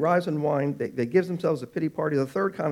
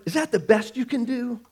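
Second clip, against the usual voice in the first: higher, disdainful question; mock perfectionist; 7 semitones higher than usual. Preacher mimics the unpleasable perfectionist, higher mock disdain. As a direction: sniff at it like a fussy boss. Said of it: "They say things like".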